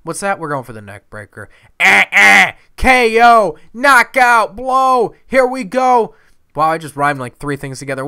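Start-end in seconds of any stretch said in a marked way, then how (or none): 1.8-6.2 loud, high move calls; hyped shouting. Real, not acted. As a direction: yell each hit with a game player's hype. Hyping the knockout move.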